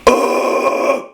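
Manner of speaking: airy forced scream over the joker line; distorted breathy scream; false chord scream. Shown as a demonstration. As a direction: push the ugh from the diaphragm into a scream. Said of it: "So it's like".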